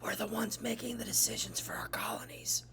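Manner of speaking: conspiratorial whisper, all breath. Acted. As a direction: whisper it as if sharing a secret plan. Teacher's dramatic aside to class.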